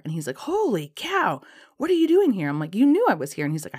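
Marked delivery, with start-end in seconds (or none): 0.4-2.5 mock surprise. Guy faking surprise at meeting. 2.7-3.5 accusing. Retold or imitated. She accuses guy of tracking her.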